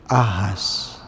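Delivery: ordinary talk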